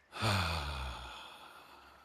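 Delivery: long audible sigh out; voiced sigh. Shown as a demonstration. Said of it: "letting my voice come out a little bit in the".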